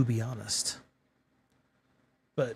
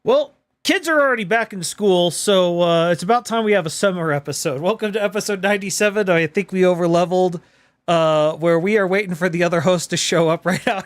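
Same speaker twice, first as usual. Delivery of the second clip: podcast intro; 4 semitones higher, 5 dB louder than usual. Acted. Opens the podcast episode.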